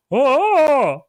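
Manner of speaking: loud, high "ohhh" orc noise; imitated orc cry. Fan mimicking a film orc for podcast listeners, loud cry. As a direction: belt out the orc's wordless cry. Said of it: "an orc that's holding a spear, and he goes".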